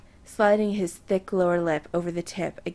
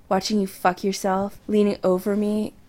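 plain reading voice

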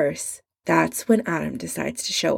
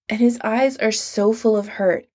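plain reading voice

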